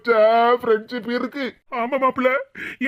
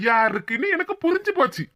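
usual speaking voice

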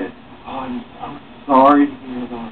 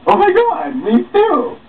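high squealing delight; excited squeal. Acted. Flirty woman squealing at the new guy, excited. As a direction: gush over him, very high.

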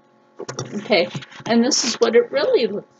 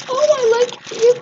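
delighted; 14 semitones higher, 6 dB louder than usual. Real, not acted. Vlogger unboxing soaps, cooing high with delight. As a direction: coo at the cute cactus. Finding a cute item.

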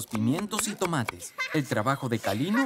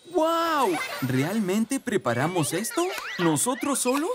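amazed; 8 semitones higher, slower than usual. Acted. Children amazed they made the salad themselves, excited. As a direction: gasp with delight at what you made.

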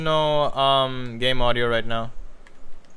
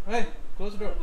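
raised call across the room; 8 semitones higher than usual. Real, not acted. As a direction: call it again, higher. Repeats louder to someone.

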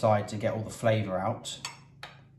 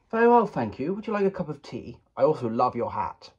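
polite gentleman; 3 semitones higher, 4 dB louder than usual. Pretend. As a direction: play the well-mannered gent offering tea. Polite posh reply to greeting.

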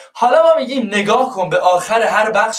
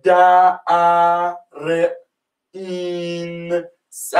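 imitating a slow speller; mock letter-by-letter spelling; much slower than usual. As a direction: spell out د ا ر این س slowly, one sound at a time. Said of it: "مثلاً میگه".

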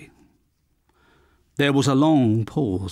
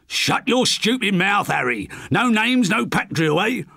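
higher, snapping shout at Harry; angry shout; 7 semitones higher than usual. Acted. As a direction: cut Harry off sharply. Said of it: "shouted the now firmly identified Bernard Potter".

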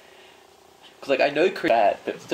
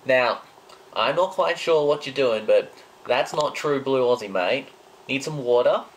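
louder broad Aussie bloke; Aussie accent; 2 semitones higher, 4 dB louder than usual. Pretend. Scolding the friend as a mock Aussie bloke, louder Aussie accent. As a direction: lecture them like a true blue Aussie. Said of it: "Do the water thing again".

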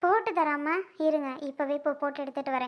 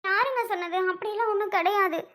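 tearful protest; 5 semitones higher than usual. Acted. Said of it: "ஏன்டி அழுகுற இப்போ?".

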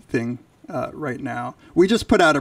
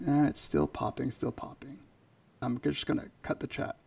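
quiet aside; 12 dB quieter than usual. Real, not acted. Muttered aside about audio pop.

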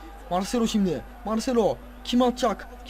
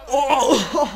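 surprised gasp; 6 semitones higher, 7 dB louder than usual. Real, not acted. Surprised at the shot.

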